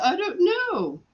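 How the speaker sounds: high-pitched mimicked helplessness; imitated nurse. Nurse asked for the doctor's email, high mock cluelessness. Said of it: "So she did the".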